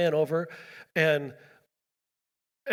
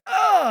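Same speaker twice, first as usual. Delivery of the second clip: loud, high "oh" of impact; mock pained yell; 8 dB louder than usual. Driver reliving the rear-end hit, pained yell. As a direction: cry out as if just hit. Acts out being rear-ended.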